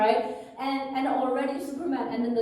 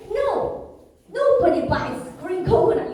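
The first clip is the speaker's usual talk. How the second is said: high, loud scolding no; vendor yelling; 6 semitones higher, 5 dB louder than usual. Retold or imitated. Coconut vendor yells at her for picking green coconut. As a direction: bark it at the customer. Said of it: "And I got yelled at".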